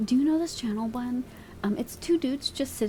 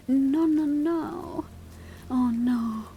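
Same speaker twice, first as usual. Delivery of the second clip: ordinary delivery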